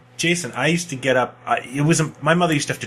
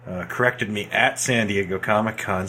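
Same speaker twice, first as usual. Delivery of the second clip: ordinary talk, nothing marked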